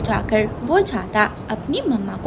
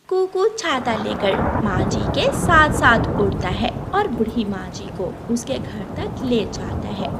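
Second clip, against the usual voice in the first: ordinary talk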